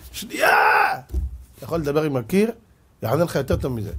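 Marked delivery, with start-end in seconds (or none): 0.1-0.9 high pleading yell; desperate cry. Retold or imitated. Shopper pleading with Russian guard, high desperate cry. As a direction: beg him to wait, crying out. Desperate plea at closed shop.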